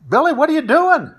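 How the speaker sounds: high, sudden cry of alarm: "Billy, what are you doing?"; startled shout. Retold or imitated. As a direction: yell out in shock, pitched high. Mickey startled by Billy's shots.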